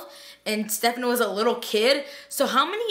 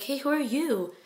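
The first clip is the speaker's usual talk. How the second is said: higher, softer questioning voice; lightly voiced puzzled mom; 4 semitones higher, 3 dB quieter than usual. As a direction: gently ask a stranger, pitched up. Voicing mom, then repeating the line.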